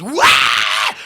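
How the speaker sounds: loud rock-frontman yell; imitated wild scream. [name] comes running on stage, screaming. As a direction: burst out with a raucous stage scream.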